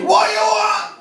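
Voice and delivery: a chip shop owner yelling for an order in a high mock Chinese accent. Shop owner shouting at customer.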